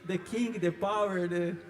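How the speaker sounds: lightly mocking the preacher's English slogan; a hint of the prophet's proclaiming voice; imitating the prophet. Pastor mocking the prophet's meme catchphrase to the church. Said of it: "a frase em inglês que ele fala".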